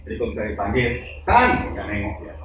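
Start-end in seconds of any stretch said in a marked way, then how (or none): 1.2-1.5 high, louder call of a name. Retold or imitated. Parent calls the child who won't turn, high call. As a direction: call the child across the room.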